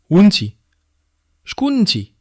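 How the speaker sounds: put-on girl stunned by the stranger; startled demand. A narrator's reading. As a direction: demand to know who she is. Girl confronts the veiled woman.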